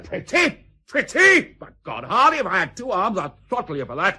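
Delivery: high, loud outraged roar; outraged shout. Acted. Admiral explodes at his captain for calling the plan pretty, outraged shout. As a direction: explode at the insult.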